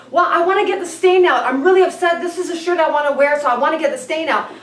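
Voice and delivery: hammed-up boyfriend fretting over his stained shirt; mock whiny boyfriend. Mimicking upset boyfriend over stain.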